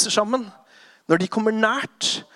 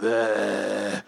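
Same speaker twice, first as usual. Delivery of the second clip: tired groan; 9 semitones lower, 3 dB quieter than usual. Acted. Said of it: "drittrøtte og bare".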